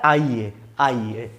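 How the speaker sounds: आइए drawn out slowly and low; slow demo word. Shown as a demonstration. Teacher to students, stretching आइए to show the added ई. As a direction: say आइए slowly, the ई clearly heard. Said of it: "तो ई इसमें जुड़ जाता है".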